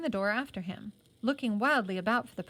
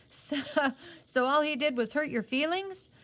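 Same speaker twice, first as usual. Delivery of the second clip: higher, teasing question through laughter; can't stop giggling as he asks; laughing while speaking; 6 semitones higher, 3 dB louder than usual. A narrator's reading. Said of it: "Then Johnny laughed".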